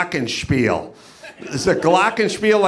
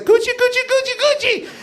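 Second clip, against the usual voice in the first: very high squealed Gucci Gucci; [name] impression; faster than usual. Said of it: "She's like".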